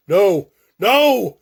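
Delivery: very high, very loud screams of no; screaming no in terror; desperate scream. Acted. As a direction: scream as the ground rushes up. Nightmare plane crash.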